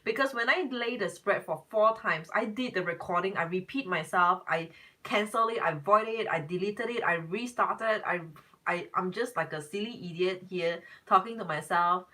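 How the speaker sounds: ordinary talk, nothing marked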